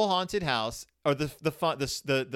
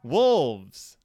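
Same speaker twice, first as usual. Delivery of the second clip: loud, drawn-out spooky announcement; dramatic story reading; 6 dB louder than usual. Acted. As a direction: read it like a haunted-house tale. Dramatic reading of page fifteen.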